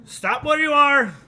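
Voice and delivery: high, slow, loud command from hiding; female guard shout. Acted. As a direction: bark the halt order at the intruders. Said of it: "It is a female voice".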